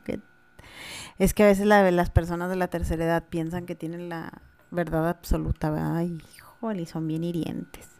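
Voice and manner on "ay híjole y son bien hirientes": whispered "Ay, híjole", softer. Real, not acted. Host reacting to hurtful old people, whispered and softer. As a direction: let it out with no voice, pained.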